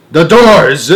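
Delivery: loud, commanding shout; witch shout. A narrator's reading. Grand High Witch shouting the doors shut to the audience. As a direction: bellow it so it fills the room. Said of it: "shouted the Grand High Witch".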